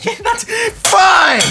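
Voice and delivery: high, loud, drawn-out yell; laugh, then holler it out long and high; sudden shout. Real, not acted. Game moment, sudden yell.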